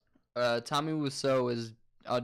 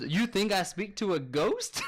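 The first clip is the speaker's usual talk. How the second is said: higher, slow mock-offended question; mildly pissed-off imitation, amused underneath; retold indignation; 7 semitones higher, slower than usual. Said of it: "and he was pissed".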